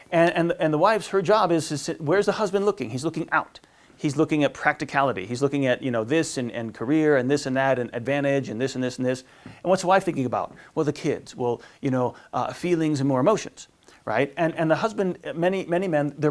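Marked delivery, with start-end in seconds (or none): none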